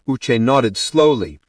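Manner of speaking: ordinary delivery, nothing marked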